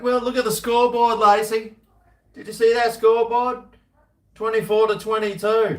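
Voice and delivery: high, loud, put-on commentator call; rugby commentator.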